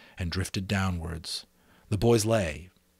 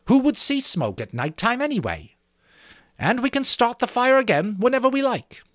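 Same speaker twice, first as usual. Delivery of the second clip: lightly voiced boy, higher, pleased with himself; proud schoolboy; 13 semitones higher, 4 dB louder than usual. Jack taking charge before the assembly, proud. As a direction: boast a little about being English.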